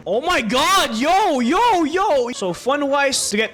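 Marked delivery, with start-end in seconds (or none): 0.1-2.3 higher, louder hyped cheer; excited yelling. Real, not acted. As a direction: hype up the big damage. Big damage number reaction.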